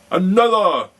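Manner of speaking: giant's roar. A narrator's reading. Giant demands another egg, a huge high roar. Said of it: "roared the giant".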